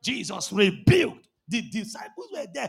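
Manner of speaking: ordinary delivery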